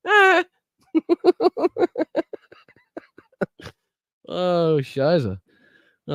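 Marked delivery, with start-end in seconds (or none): 0.1-0.4 sudden loud high-pitched yelp; high squeal. Real, not acted. Host amused by viewers' chat names, high squeal. 0.9-2.3 rapid, high hooting giggle; high-pitched laugh. Real, not acted. Host cracking up at chat names, high giggle.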